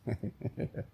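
silent wheezy chuckle; breathless laugh. Real, not acted. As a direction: huff out a quiet laugh. Laughing at counseling idea.

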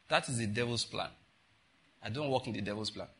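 softly, in a low, unruffled voice; very calm. Retold or imitated. Prophet answering the woman with her vision, very calmly. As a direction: state it quietly, completely untroubled. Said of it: "He answered the woman very calmly".

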